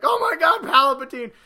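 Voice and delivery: high, breathless exclamation; nearly faint with excitement; hearing the cackle, high and breathless; excited gasp. Retold or imitated. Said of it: "I was just like".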